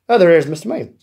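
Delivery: normal talking voice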